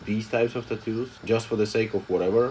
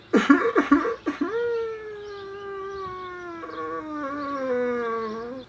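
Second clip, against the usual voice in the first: long, breathy giggle way up high; can't stop laughing at your own bit. Real, not acted. Streamer bursts out laughing at her own fake cry.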